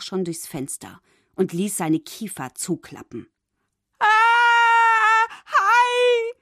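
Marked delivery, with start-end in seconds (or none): 4.0-6.3 very high, loud panicked shriek; terrified scream. A narrator's reading. Fish spotting the shark, screaming loud. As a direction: scream in terror. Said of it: "schrie Fabius".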